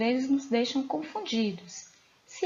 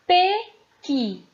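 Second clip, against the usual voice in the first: "pequi" sounded out in two loud, higher, separated syllables; syllable-by-syllable demonstration; 7 semitones higher, 7 dB louder than usual. Shown as a demonstration. Teacher sounding out the example word "pequi" for pupils, syllable by syllable. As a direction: pronounce "pequi" as two distinct beats, PE, QUI. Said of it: "qual é a sílaba tônica".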